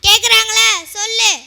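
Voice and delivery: loud, forceful prompt; loud scolding order. Acted. Mother orders daughter to answer the passer-by, loud scolding. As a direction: demand an answer from a child.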